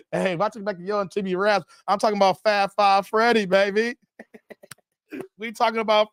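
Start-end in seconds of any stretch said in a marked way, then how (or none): none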